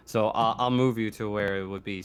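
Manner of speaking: usual speaking voice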